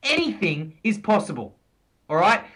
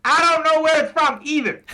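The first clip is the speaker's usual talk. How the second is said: higher, hammed-up Aussie twang; host admits he can't place it, still in the Aussie voice; mock Aussie accent; 6 semitones higher, 3 dB louder than usual. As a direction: laugh it off in the put-on accent. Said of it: "let's turn on the Aussie again".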